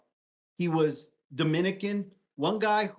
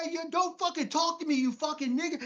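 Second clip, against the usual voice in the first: high, mocking playground threat; Brooklyn kid trash talk; 10 semitones higher than usual. Imitating his childhood crew trading insults, high and mocking. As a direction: threaten your buddy, half joking, pitched up. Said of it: "You're like".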